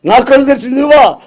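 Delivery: fast, high and loud amazed cry; overwhelmed recognition. Acted. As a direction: gasp out that it is the Lord. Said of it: "అని లేచి".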